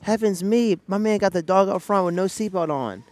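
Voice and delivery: high, loud, theatrical announcer talk; hammed-up host. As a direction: play the overexcited show host. Mock commercial host voice.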